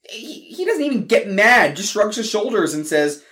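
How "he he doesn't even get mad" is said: ordinary delivery, nothing marked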